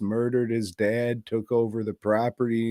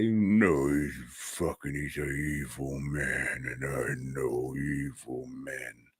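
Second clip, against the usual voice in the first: Rambo; 9 semitones lower, 9 dB quieter than usual. Acted. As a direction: drop way down into a hushed, husky voice. Voices Rambo warning the girl.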